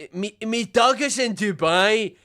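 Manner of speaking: a slow, mild mock Geordie "Me dog is in Dubai"; a lightly voiced Newcastle dog owner; mock Newcastle accent. Streamer mimics the dog owner in a light mock Newcastle accent. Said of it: "why would he be".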